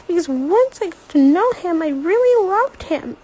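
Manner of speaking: high, loud crying outburst; tearful rage. A narrator's reading. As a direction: pour out grief as fury. Girl raging over grandfather's neglect.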